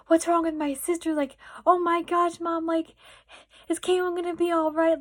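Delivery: high, loud mock panic; imitated tearful panic. Acting out herself panicking to mom about her sister, high and loud. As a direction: retell a freak-out, pitched right up. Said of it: "I started crying".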